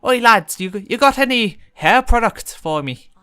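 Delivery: loud, high attempt at Irish; hammed-up Irish, pitched well up; bad Irish accent. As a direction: call out as an imitated Irish stranger. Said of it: "not gonna do a very good Irish accent".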